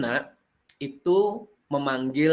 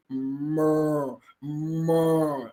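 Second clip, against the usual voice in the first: low mooing cow; teacher imitating a cow in a story demo; cow's moo; 3 semitones lower than usual. Shown as a demonstration. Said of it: "itu biasanya suara sapi".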